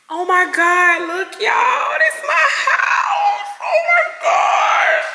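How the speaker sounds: very high, overwhelmed cry; tearful wail. Real, not acted. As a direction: wail as tears take over on seeing your lost house. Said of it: "I'm finna cry".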